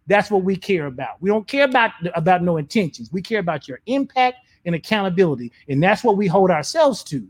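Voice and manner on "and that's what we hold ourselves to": ordinary talk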